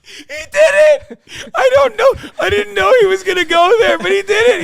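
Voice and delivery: very high, loud squeal through laughter; hysterical laughter. Real, not acted. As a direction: shriek it while cracking up.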